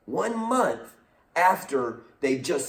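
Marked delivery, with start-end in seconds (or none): 0.1-0.9 one month said high and hard; pitched-up emphatic repeat; stress on month. Real, not acted. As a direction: lift up on "one month" to drive it home. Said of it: "emphatic repeat".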